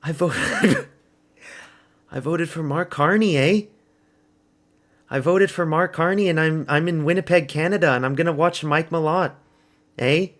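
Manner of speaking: playful put-on Canadian accent. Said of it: "the Canadian accent".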